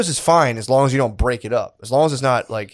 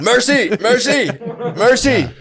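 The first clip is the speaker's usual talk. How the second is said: mock begging for mercy; 11 semitones higher, slower than usual. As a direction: beg for mercy like a groveling servant. Mock begging after prostrate joke.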